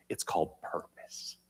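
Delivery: soft whispered reveal; hushed reveal. Acted. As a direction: whisper it as a secret. Dramatic reveal of key word.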